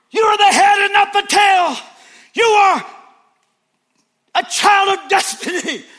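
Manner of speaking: very high, very loud mock hype preacher; shrill stadium-preacher parody; hammed-up preacher shout. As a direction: scream it like a revival preacher. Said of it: "Next week".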